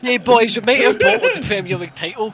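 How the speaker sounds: high, loud hammed-up team rallying call; rally the squad like a joke; mock footballer cheer. Liverpool player cheering his teammates about pizza, hammed-up. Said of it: "Liverpool players are getting pizza".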